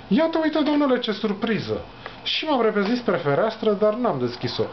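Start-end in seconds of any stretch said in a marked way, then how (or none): 0.1-1.8 jumps up high on Iată, then settles; mock-delighted surprise at a late visitor; surprised greeting. Narrator startled by Sima at the window, surprised.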